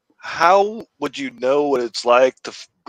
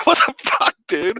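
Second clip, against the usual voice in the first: shocked defender; 12 semitones higher than usual. Pretend.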